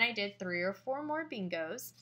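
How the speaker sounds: ordinary delivery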